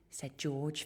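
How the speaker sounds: plain reading voice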